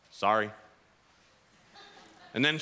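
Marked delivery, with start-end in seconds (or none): 0.1-0.5 low, sulky mumbled sorry; grudging sorry. Retold or imitated. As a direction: mumble it like a pouting kid. Child's grudging apology.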